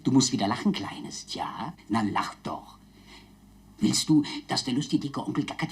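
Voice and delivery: put-on whispered coaxing to a child; gentle hushed whisper. (sanft geflüstert)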